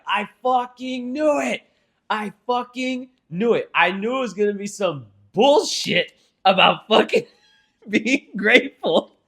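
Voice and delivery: high, drawn-out mock outrage; exasperated scorn. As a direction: squeal it slowly in comic disbelief, breaking into laughter. Acting out own scornful reaction.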